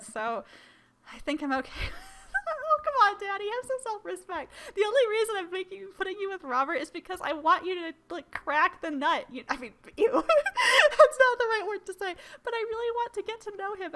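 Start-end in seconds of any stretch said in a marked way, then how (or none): 2.5-9.2 higher, chiding daughter voice; Amanda scolding. Pretend. Voicing Amanda scolding her dad. 9.3-13.9 giggling daughter. Pretend. Amanda giggling at own word.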